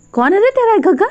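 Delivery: high, scornful jeering challenge; mocking retort. Wang jeers at the whiny boy, high and scornful. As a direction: sneer the question back at him.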